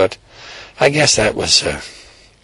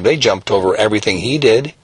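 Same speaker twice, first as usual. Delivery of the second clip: ordinary delivery, nothing marked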